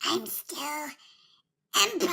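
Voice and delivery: faint, breathy rasp; dying Emperor whisper. Retold or imitated. Imitating the dying Emperor's whisper. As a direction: breathe it out like a last gasp. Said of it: "And he's like".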